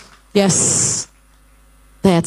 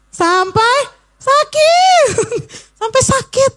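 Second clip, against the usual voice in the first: high emphatic repeat; much slower than usual. Shown as a demonstration. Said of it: "Ulangi coba". ("sampai sakit" diulang dengan nada jauh lebih tinggi)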